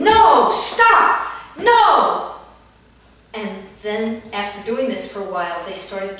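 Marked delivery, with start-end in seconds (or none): 0.0-2.4 loud, high yell of defiance; shouting. Retold or imitated. Therapist acts out angry kindergartners in movement group, shouting. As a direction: belt it out like angry stomping kids.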